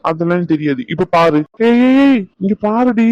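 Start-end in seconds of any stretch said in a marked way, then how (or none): 1.7-3.1 playful calling out. Acted. Husband teasing wife to look.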